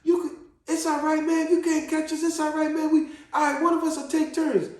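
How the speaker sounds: put-on high, loud reassurance from older kids; kids consoling. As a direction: imitate big brothers talking over each other to console. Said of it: "And they said".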